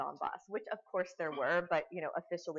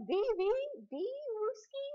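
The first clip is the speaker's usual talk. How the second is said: much higher, stammered bad Russian; broken Russian question; 3 dB louder than usual. Lecturer imitating the host asking soldiers, high stammering bad Russian. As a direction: stammer the question like a lost tourist. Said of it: "it's like".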